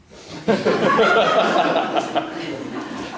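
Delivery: hearty laugh at own joke. Real, not acted. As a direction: burst out laughing.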